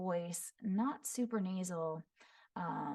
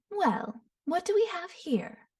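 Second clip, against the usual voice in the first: higher, sing-song and teasing; Yae Miko's voice; 11 semitones higher, 4 dB louder than usual. Shown as a demonstration. Voice actor demoing a sly shrine maiden, sing-song and higher. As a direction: purr it musically, amused. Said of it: "very musical, mid-tone, enunciated".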